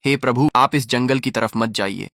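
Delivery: ordinary delivery, nothing marked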